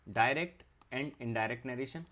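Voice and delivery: slow, each word set apart; slow dictation. Shown as a demonstration. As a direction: dictate it slowly for notes. Teacher dictating syllabus term.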